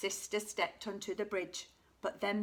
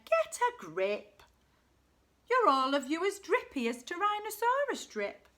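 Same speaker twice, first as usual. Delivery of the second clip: scolding dad; 8 semitones higher, 5 dB louder than usual. A narrator's reading. Dad scolding his trembling family, emphatic reproof. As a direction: build into a louder, higher rebuke.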